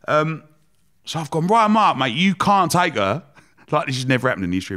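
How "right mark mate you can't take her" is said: raised, insistent, higher and louder; loud retold protest to the boss; retold firm call-out. Retold demand in boardroom.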